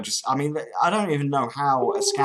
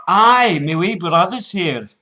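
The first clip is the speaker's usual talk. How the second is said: loud, high Scottish voice; broad Scottish accent; 4 semitones higher, 8 dB louder than usual. Pretend. Prank caller offering scammer his brother, loud Scottish. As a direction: belt it out as a put-on Scot.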